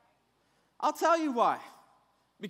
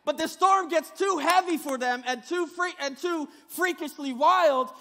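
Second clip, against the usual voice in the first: ordinary talk, nothing marked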